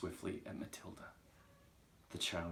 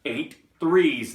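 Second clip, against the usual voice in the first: very loud, raised bellow; furious shout; 8 semitones higher, 18 dB louder than usual. Acted. Trunchbull swinging Wilfred by his ankle, shouting. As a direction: roar the figures at him. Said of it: "the Trunchbull shouted".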